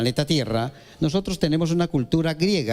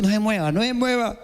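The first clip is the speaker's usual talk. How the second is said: raised voice ordering someone not to move; commanding stillness; 6 semitones higher than usual. Acted. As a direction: urge them to freeze, higher and firmer. Preacher acting a stillness command. (orden de no moverse, voz más alta)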